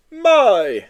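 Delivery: loud jolly Santa. Acted. Santa greeting a big visitor, jolly loud voice. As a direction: boom it like Father Christmas. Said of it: "said Santa".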